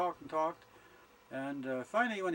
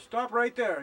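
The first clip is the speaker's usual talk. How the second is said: angry shout; 8 semitones higher, 8 dB louder, slower than usual. Acted. Old husband shouting at the young man to stop. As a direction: yell it out, loud and high.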